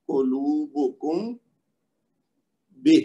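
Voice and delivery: very slow قلوبکم, a pause, then a short بہ; recited example. Shown as a demonstration. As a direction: recite قلوبکم drawn out, with بہ set apart. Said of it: "کہ یہ جو ہے".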